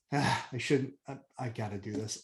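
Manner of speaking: low, soft resigned self-talk; reluctant giving in. Retold or imitated. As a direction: give in with a low, soft sigh. Retelling reluctant giving in to temptation.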